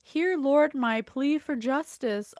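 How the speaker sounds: loud, earnest opening plea; fervent prayer reading. Acted. Reader opening the psalm for her viewers, loud fervent prayer. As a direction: call out to the Lord with full conviction.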